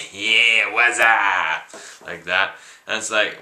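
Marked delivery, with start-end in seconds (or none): 0.1-1.8 louder, put-on casual buddy voice; breezy fake greeting. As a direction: play it cool to cover a lie. Fake friend dodging the truth.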